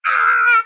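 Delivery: short high mock shriek; mock scream. Guest reenacting all three screaming, high shriek. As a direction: squeal it like the roadside panic.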